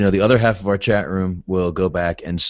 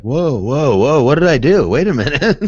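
loud startled whoa; surprised; 6 semitones higher, 5 dB louder than usual. Pretend. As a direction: panic on realizing what happened. Imagined shocked time traveller.

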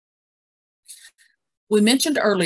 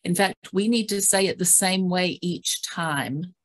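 usual speaking voice

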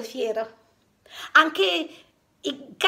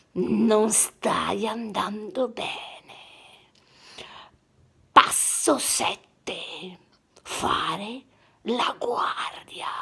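slow low whisper; whispered lion master; 4 semitones lower, much slower than usual. Acted. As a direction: whisper like a wise old master.

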